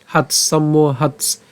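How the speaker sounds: হাৎস alone, then the whole সম্মোহাৎস, slowly; cluster demo. Shown as a demonstration. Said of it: "দন্তাস্ব পর্যন্ত আমরা এখানে উচ্চারণ করতে".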